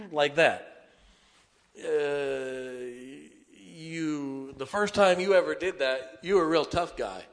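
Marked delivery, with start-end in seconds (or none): none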